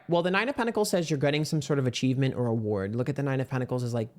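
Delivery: ordinary delivery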